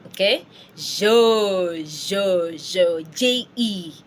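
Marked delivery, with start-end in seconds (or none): 0.8-3.0 je drawn out loudly with rounded lips; French E sound. Shown as a demonstration. Teacher drilling learners on J E, French pronunciation. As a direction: je repeated as a long "zhuh". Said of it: "J is je".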